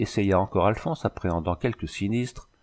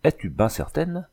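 raised-pitch incredulous question; surprised demand for confirmation; astonished exclamation; 7 semitones higher than usual. A narrator's reading. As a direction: ask it high, in disbelief. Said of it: "s'écria-t-il".

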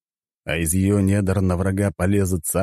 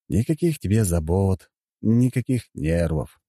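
ordinary delivery

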